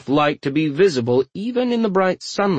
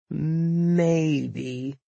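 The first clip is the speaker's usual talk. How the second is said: drawn-out, higher, hesitant word; wary; 4 semitones higher, 3 dB quieter than usual. A narrator's reading. Tori answering his brother's request, warily. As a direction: stretch it out cautiously. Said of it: "he said warily".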